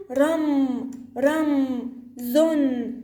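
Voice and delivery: رم and زن held, with pauses between; syllable by syllable. Shown as a demonstration. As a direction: sound out رم, then زن, final consonants hummed. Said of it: "بحاول أقرأها أنا دمج".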